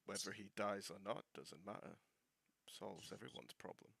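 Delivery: low, very soft conspiratorial whisper; hushed scheming aside to an ally; whispered in character. Acted. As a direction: murmur it secretly into his ear. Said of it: "I whisper into his ear".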